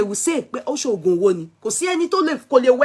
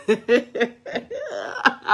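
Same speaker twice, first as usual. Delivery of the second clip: short laugh. Real, not acted. Laughs at fear of being sued.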